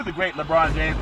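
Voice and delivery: ordinary talk, nothing marked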